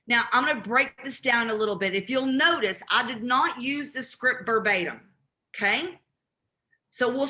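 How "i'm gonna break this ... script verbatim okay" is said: ordinary delivery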